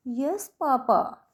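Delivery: यस papa eased off gently, falling at the end; light stress on यस papa. Shown as a demonstration. Teacher showing students reduced stress in a rhyme, यस papa said lightly. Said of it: "यस papa जब हमने धीरे बोला है ना".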